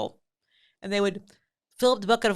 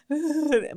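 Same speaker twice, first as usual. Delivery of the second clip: a laugh breaking through; 8 semitones higher, 5 dB louder than usual. Real, not acted. Telling the punchline, laughing. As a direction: chuckle through the words.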